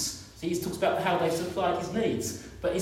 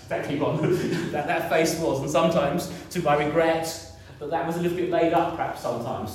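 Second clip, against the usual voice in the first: ordinary talk, nothing marked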